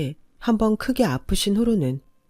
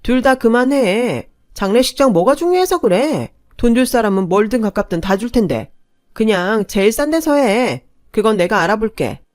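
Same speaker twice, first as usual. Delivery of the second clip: raised, heated voice, pitch pushed up; relatives bickering; 4 semitones higher, 6 dB louder than usual. Acted. In-laws quarrel over the funeral costs, heated and loud. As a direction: snap at the squabbling family.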